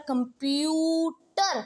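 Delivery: Computer with a long, heavily stressed प्यू; stress on PYU. Shown as a demonstration. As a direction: stretch the middle प्यू of computer. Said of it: "पी यू पे बहुत ज़्यादा stress लगा रही हूँ".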